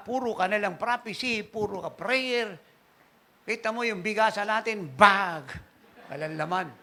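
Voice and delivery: a hint of an exasperated wife; nagging wife. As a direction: play the wife nagging him about no rice. Pastor imitates nagging wife.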